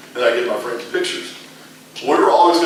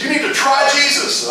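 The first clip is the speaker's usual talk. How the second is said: high, slow, pressing plea; eager urging; 4 dB louder than usual. Retold or imitated. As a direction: urge him, full of joy. Friend urging him eagerly.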